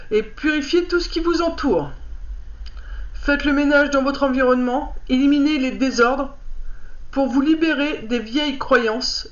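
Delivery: lire le livret posément, un peu plus haut que la parole; lecture à voix haute. Acted. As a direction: read out a card's message clearly, phrase by phrase. Reads card booklet aloud. (lecture appliquée à voix haute)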